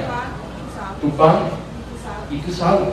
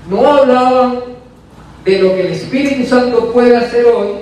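higher, fervent preaching voice; 8 semitones higher than usual. Acted. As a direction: preach it out to the congregation. Preacher raises voice in testimony.

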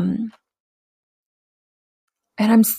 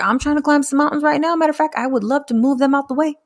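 higher, eager self-quote, building up; playfully hyped, like she's ready to climb; eager retold thought; 6 semitones higher, faster than usual.

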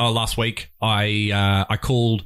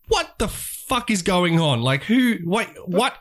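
high, drawn-out retold bafflement; incredulous, pitched up and stretched slow; exasperated disbelief; 7 semitones higher, much slower than usual. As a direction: act out being baffled on hold. Retelling bafflement while on hold.

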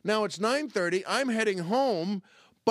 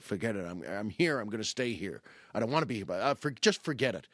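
low, grudging give-in; retold weary surrender; resigned; 8 semitones lower than usual. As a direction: give up and stay, voice dropped low.

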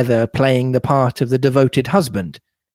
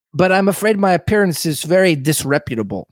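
higher-pitched European mad scientist voice; Doctor Bauerstein; 4 semitones higher, faster than usual. Acted. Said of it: "European mad scientist".

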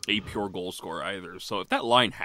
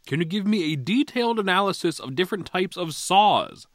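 mock announcer; 11 semitones higher, 9 dB louder than usual. Mock serious follow-up question.

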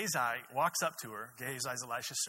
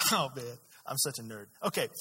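ordinary delivery

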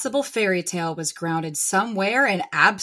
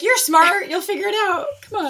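high, louder, coaxing mimicry; mock Jacob; 11 semitones higher, 6 dB louder than usual. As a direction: tease like a pushy boyfriend. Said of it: "He's like".

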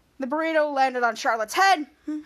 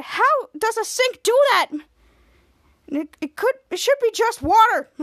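slow, high bewildered question; puzzled high voice; 7 semitones higher, 5 dB louder, slower than usual. Retold or imitated. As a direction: sound baffled by the sink. Retold character puzzled.

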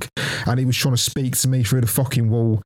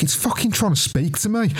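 panicked; 9 semitones higher than usual. Retold or imitated. Panicked inner thought.

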